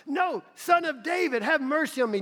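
high, loud insistent plea. Retold or imitated. Blind man crying out again.

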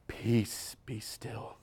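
put-on soft, low whisper to hush a baby; whispered calming. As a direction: imitate soothing a storm in a whisper. Hushing crying niece, retold.

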